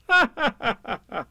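high mocking laugh; scornful laugh. Rabbit laughs at Piglet, high scornful laugh. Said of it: "Rabbit gave a laugh".

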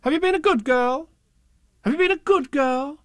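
high, sing-song rising question; high-pitched, insistent grown-up; questioning parents. A narrator's reading. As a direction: quiz a small child in a high parental coo. Parents voiced repeating their question.

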